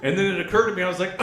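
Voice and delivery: ordinary talk, nothing marked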